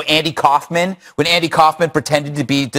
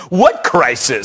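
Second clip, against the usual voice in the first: mocking media tone; 3 semitones lower, slower than usual. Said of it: "they turn into this mocking tone".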